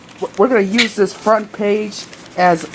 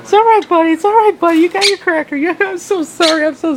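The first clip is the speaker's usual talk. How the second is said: much higher cooing voice for a pet bird; 10 semitones higher, faster than usual. Real, not acted. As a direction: coo at the parrot in a much higher voice. Baby talk to pet bird.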